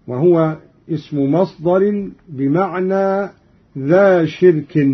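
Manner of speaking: usual speaking voice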